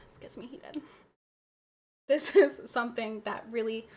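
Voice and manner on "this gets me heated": breathy whispered aside, much softer. Real, not acted. Streamer confiding to chat how worked up she is, whispered.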